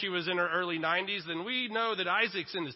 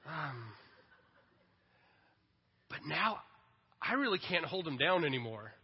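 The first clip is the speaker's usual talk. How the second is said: ordinary talk, nothing marked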